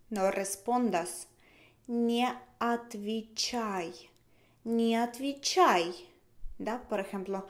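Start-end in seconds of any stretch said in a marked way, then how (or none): 1.9-4.1 slow model. Shown as a demonstration.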